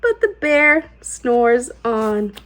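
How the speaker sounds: ordinary delivery, nothing marked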